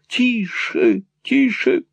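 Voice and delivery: high-pitched, urgent shushing; agitated hushing. A narrator's reading. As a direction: hush them anxiously, pitched high. Flustered brother hushing the others.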